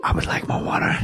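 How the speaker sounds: soft whispered Walken. Acted. Comic doing whispered Walken request. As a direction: breathe it out like Walken, voiceless. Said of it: "go down into the whisper".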